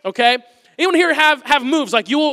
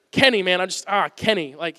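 ordinary delivery

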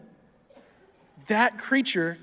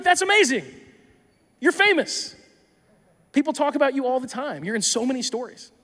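starstruck excitement; 6 semitones higher than usual. Pretend. Preacher imagining meeting the donkey owner in heaven, gushing excitedly. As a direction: squeal it like meeting a celebrity.

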